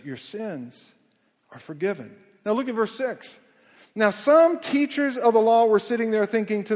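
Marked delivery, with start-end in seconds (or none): 0.0-2.2 much softer and lower, almost under the breath; hushed Jesus. Acted. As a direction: say it softly, as a tender absolution. Voicing Jesus' words, hushed.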